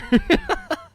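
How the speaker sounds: shrill giggle; high squeaky laugh. Real, not acted.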